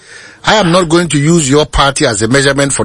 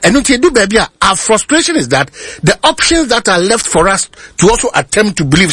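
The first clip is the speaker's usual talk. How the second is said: ordinary delivery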